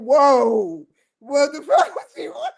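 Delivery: loud, high quote broken by laughter; laughing mock shout. Viewers reacting to him, laughing mock yell. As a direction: yell it while cracking up. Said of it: "you would be saying".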